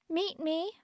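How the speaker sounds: high, soft, mocking squeak; chipmunk high-pitched voice. A narrator's reading. Narrator reading her friend's note aloud, chipmunk high-pitched voice. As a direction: read it out like a little chipmunk. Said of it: "with a chipmunk high-pitched voice".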